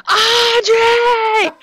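high-pitched coach scream; Coach Flan. Retold or imitated. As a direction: yell the name like an angry coach. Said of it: "so it's kind of a high-pitched scream".

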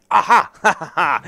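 high loud gleeful cackle; real giggling glee; cackling laugh. Real, not acted. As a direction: burst into a mischievous laugh. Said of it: "I'm laughing 'cause it's hilarious".

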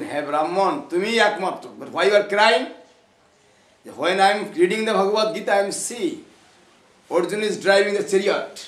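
loud, high, declaimed dialogue; impassioned retelling. Retold or imitated. Preacher acting out Mahaprabhu questioning the weeping Brahmin, loud and impassioned. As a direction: call it out to the whole gathering.

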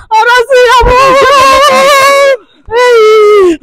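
real distressed wailing. Real, not acted. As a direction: wail as you lament being abandoned. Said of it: "I understand how you feel".

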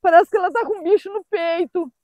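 high, tearful voice; real choked-up crying while talking; nearly crying. Real, not acted. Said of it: "tô quase chorando". (voz de choro, emocionada)

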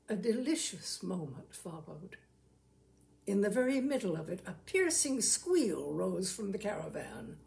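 plain reading voice

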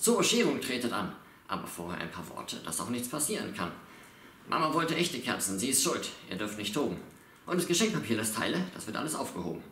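acted fatherly announcement; father's speech. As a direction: address the family like a father laying down rules. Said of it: "die Ansprache des Vaters".

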